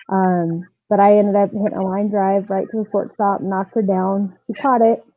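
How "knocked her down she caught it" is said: ordinary talk, nothing marked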